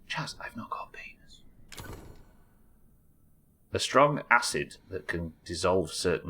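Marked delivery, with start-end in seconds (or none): 0.1-1.4 breathy joking whisper. Real, not acted. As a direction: whisper it as an aside. Aside to chat.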